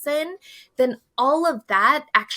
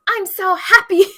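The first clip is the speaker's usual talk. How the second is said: mock-cheerful, much higher and louder; fake cheer; 10 semitones higher, 8 dB louder than usual. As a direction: force a bright happy chirp you don't mean. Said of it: "it's hard to be like".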